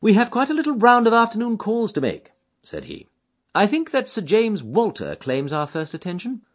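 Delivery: keen detective to his friend; Holmes voice. Acted. Holmes to Watson on the train, eager and keen.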